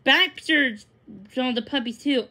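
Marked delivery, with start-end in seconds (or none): none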